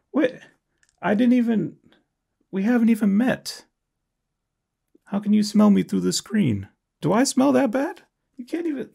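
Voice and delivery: high, offended disbelief; protest, outraged, to the comment; indignant offended pitch rise. Real, not acted.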